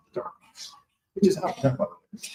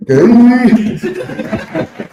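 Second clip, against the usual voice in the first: laughing; 9 semitones higher, 7 dB louder than usual. Real, not acted. Overcome after an emotional story.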